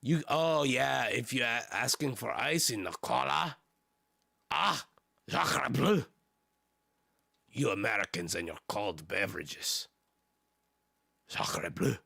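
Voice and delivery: hammed-up mock French accent. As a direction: play an offended Frenchman with a bad accent. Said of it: "With that French accent".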